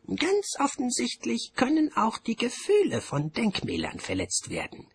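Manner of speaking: very high, put-on official voice; high-pitched bursar making a declaration; bursar character. A narrator's reading. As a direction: pronounce the moral in a high, loud voice. The bursar speaks in the story.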